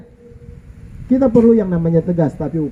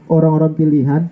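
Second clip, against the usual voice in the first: ordinary talk, nothing marked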